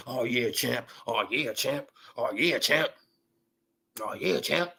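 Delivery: low, quiet sucking-up voice; mock fawning host. Vlogger mocking show hosts fawning over Wilder, low and quiet. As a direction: imitate the hosts buttering up the champ.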